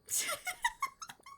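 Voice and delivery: high-pitched giggle; a quick squeaky laugh; bursting into a high laugh. Real, not acted. Laughs at own joke.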